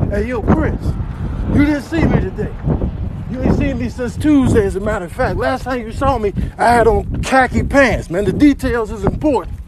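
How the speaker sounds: high, forceful hustler voice; insistent character. Acted. Buddy telling Chris what to say he saw, insistent.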